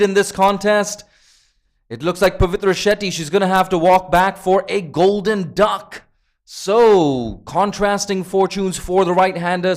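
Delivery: loud, high-pitched live cricket commentary; excited play-by-play. Real, not acted. Commentator tells viewers of two wickets in two balls, excited play-by-play.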